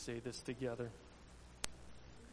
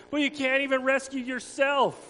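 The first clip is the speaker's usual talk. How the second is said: higher, taunting crowd shout; mock shout; 10 semitones higher, 10 dB louder than usual. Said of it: "people shouted".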